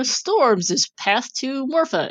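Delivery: ordinary talk, nothing marked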